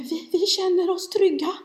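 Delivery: high, meek little-kid imitation; humble little child. Youngest child reassuring mamma after her yelling, imitated humble voice. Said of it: "säger den minste så ödmjukt så här".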